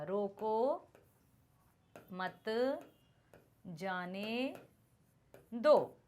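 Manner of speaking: long pause after every word, रोको ... मत ... जाने ... दो; word-by-word, unpunctuated. Shown as a demonstration. Teacher reading an unpunctuated sentence to students, word by word. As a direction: read रोको मत जाने दो as four isolated words, softly. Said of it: "इसको कैसे पढ़ेंगे".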